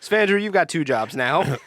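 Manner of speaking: mock boss announcement. Mock boss reassigning jobs.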